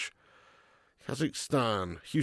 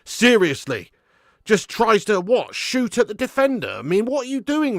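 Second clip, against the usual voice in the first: exasperated yell; 7 semitones higher, 6 dB louder than usual. Real, not acted. Fan berates winger.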